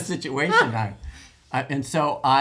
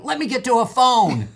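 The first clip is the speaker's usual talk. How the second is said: high, loud heroic shout; raised voice, playing at urgency; mock urgent cry; 10 semitones higher, 7 dB louder than usual. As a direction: call it out like a mock emergency. Acted urgent call for help.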